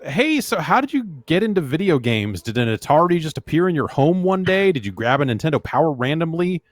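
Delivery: hammed-up voice for the emailer; mock excited reading. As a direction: read it like an excited fan asking. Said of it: "Chris from Chicago writes in and says".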